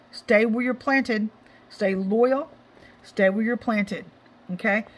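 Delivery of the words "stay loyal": ordinary talk, nothing marked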